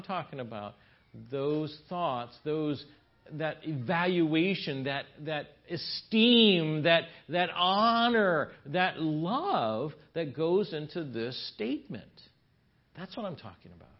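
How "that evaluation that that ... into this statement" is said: each word drawn out slow and louder; slow emphatic build. Real, not acted. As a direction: lean on every noun, building.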